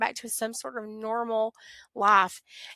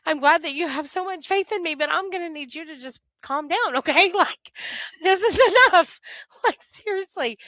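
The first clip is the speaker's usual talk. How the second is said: higher, louder sarcastic laugh-through; laughing exasperation; 8 semitones higher, 8 dB louder than usual. Retold or imitated. Daughter sarcastically telling God it's enough, laughing and exasperated. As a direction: tell God to calm down, laughing in disbelief.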